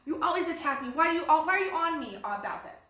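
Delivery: acted defensive lashing out, loud and high; anxious, frustrated outburst. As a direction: play a fed-up partner snapping under attack. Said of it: "full of anxiety and frustration and everything".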